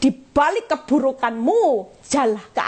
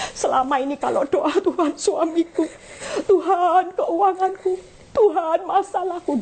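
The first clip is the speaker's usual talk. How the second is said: rising higher with a laughing sob; mock complaining prayer; 7 semitones higher than usual. Preacher confesses how she used to pray, mock whining. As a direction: poke fun at her own old prayers. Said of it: "saya bilang".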